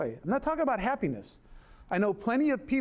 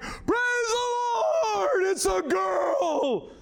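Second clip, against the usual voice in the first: joyful shout; 13 semitones higher, much slower than usual. Retold or imitated. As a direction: shout it to the rafters like a crowd at a birth. Everyone cheering the birth.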